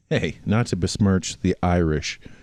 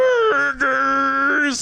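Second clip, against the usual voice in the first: caricature American shout; much slower than usual. Retold or imitated.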